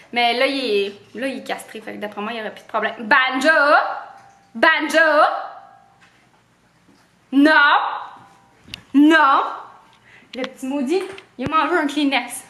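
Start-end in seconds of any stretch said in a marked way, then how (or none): 3.0-5.2 sharp call to the dog, rising. Real, not acted. 7.3-9.4 loud scolding no; firm no. Real, not acted. Dog ate a Kleenex.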